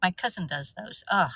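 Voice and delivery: usual speaking voice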